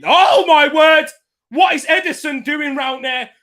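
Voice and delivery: high, loud disbelieving shout, the first words drawn out; shocked outcry. Real, not acted. Fan watching the keeper dribble near his goal, shocked loud outcry. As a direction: yell in disbelief at the keeper's gamble.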